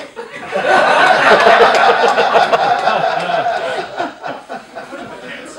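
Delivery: loud wheezing laughter; can't stop laughing at the story; long laughing fit. Real, not acted. Laughs at nervous truth.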